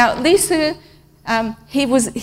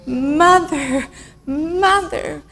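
plaintive echoed cry; 4 semitones higher, much slower than usual. Retold or imitated. Translating the child's cry for mother.

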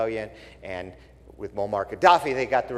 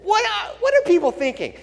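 high, louder exasperated question; exasperated disbelief; 4 dB louder than usual. Real, not acted. Pastor rants at rioters, high exasperated disbelief. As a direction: ask in loud disbelief.

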